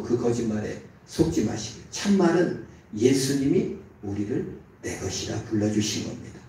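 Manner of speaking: ordinary talk, nothing marked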